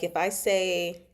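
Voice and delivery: ordinary delivery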